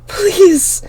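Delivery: high, loud anguished scream; screamed plea. A narrator's reading. Girl screaming for the killer to stop. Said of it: "I screamed".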